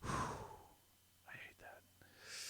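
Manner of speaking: muttered under breath; whisper. Real, not acted. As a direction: whisper it to yourself, annoyed. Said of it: "frustrated whisper to self".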